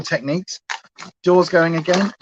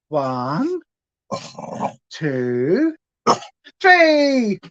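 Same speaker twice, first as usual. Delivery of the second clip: excited countdown; 2 semitones higher, much slower than usual. Real, not acted. Countdown before the throw.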